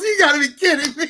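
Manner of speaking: fast, laughing disbelief; can't believe she got him again; exasperated disbelief. Retold or imitated. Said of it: "He goes".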